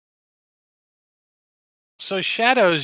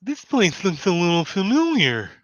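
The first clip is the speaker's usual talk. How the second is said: high, loud little creature voice; Lulu character voice; 6 semitones higher, 5 dB louder than usual. Acted. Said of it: "Lulu's like".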